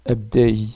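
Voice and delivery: abdei said loud with the final i stressed; teacher calls out abdei for the class; abdei with added i. Shown as a demonstration. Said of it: "J'ajoute le i".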